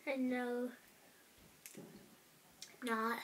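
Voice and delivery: talking while chewing; chewing candy. Real, not acted. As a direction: mouth full of sticky taffy. Seen 2: bites candy off wrapper, then grimaces chewing sticky taffy.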